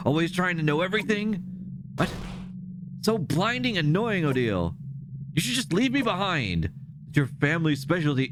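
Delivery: cutting, rising bitter jabs; bitter Siffrin. Acted. Siffrin taunting Odile about her family, bitter. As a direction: wound her on purpose.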